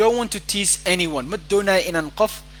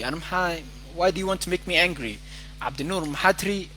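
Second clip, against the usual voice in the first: ordinary talk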